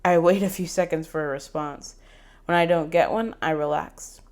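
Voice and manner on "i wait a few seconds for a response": usual speaking voice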